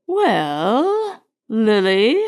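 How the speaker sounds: mildly haughty, grudging address; grudging concession. A narrator's reading. Elder lady addressing a servant by name, grudgingly. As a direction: stoop to use the name, a little reluctantly. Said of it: "as if she had made a distinct concession".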